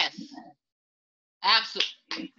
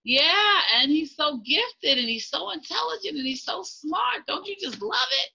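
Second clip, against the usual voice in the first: gushing; 8 semitones higher than usual. Real, not acted. Host gushing over gifted son.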